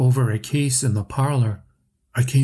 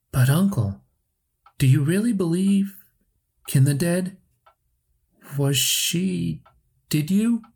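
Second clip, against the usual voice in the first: halting questions; 6 semitones higher than usual. A narrator's reading. Nephew stammers broken frightened questions.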